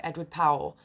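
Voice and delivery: ordinary talk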